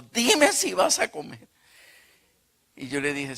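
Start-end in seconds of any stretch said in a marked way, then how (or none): 0.0-1.5 high, loud, slow demand from the mother; imitating a mother yelling; mother's yell. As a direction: hurl the question at him like an angry mom. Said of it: "ella me gritó y me dijo".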